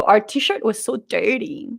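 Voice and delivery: ordinary delivery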